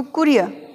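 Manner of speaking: कुरियर with short U, silent final R; correct pronunciation. Shown as a demonstration. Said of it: "छोटा ऊ है".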